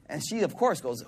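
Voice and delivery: ordinary delivery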